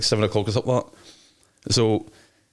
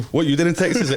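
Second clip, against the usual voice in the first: higher, incredulous retold question; mock disbelief; 7 semitones higher than usual. Promoter retelling his shock at the booked DJ's location, incredulous. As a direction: replay the baffled reaction with a raised pitch.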